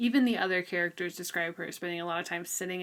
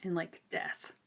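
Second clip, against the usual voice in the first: whispered; 6 dB quieter than usual. Real, not acted. Ominous aside about grandma.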